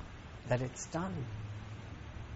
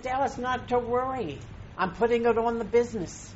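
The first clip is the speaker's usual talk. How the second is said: higher, breezy friend telling him not to worry; Doug's reassuring voice; 8 semitones higher than usual. Retold or imitated. Friend on the phone offering the Italy trip, higher and breezy. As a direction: lift the voice as the easygoing friend. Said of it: "He said".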